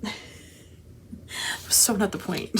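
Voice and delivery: "It was so not the point" breathy and amused; laughing whisper. Real, not acted. Laughing at own tangent.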